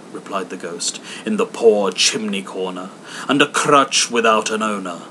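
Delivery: booming spectral voice foretelling a death; solemn ghost. Acted. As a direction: intone it like a doom-laden spirit. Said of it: "ghost's solemn prophecy".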